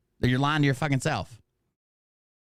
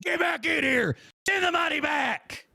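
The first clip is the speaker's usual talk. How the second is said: hammed-up screaming rant, much higher; mock furious yell; 12 semitones higher, slower than usual. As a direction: play a betrayed streamer screaming at a deserter. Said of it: "You think I'd be like".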